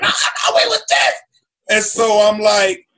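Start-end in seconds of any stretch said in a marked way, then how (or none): 0.0-1.1 high, laughing flustered protest; mock panicky explanation; panicked explaining. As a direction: plead with the police. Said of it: "you trying to explain".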